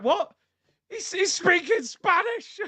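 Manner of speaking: high, drawn-out mock shock; mock outrage. Imitating fans shocked at the Spanish, high and incredulous. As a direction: squeal it in mock disbelief.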